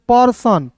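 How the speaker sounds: "पर्सन" with a short er sound; right pronunciation. Shown as a demonstration. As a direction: say "पर्सन", not "पेड़सन". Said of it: "P E R S O N". ("पर्सन" का सही उच्चारण)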